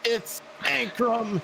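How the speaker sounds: high, slow stretched name call; slow drawn announcer call. Pretend. Dragged mock announcer call.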